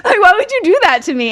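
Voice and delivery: half-laughing exasperated plea; laughing outrage at the masseuse. Real, not acted. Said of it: "laughing at the memory".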